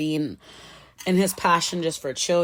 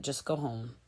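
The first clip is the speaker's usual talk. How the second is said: muttered aside; 3 semitones lower, 9 dB quieter than usual. Real, not acted. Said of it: "self-eliminate".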